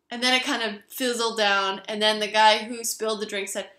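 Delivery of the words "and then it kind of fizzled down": usual speaking voice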